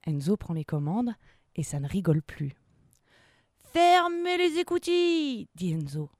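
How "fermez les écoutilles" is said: loud, high, drawn-out captain's command; shouted order. Acted. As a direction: call out orders to the crew. Captain's order in submarine game. (ordre crié de capitaine)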